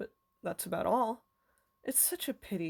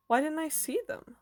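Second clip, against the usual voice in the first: bewildered; 8 semitones higher, 3 dB louder than usual. A narrator's reading. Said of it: "Tom was bewildered".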